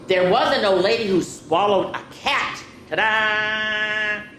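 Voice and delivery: lively storybook read-aloud; reading to children. Acted. Teacher reading a picture book to children, lively. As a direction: read it out to the kids with bounce.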